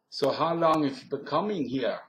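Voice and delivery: lilting Pennsylvania Dutch question; Pennsylvania Dutch accent. Shown as a demonstration. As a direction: ask it like a Pennsylvania Dutch local. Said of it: "this is the accent I often heard there".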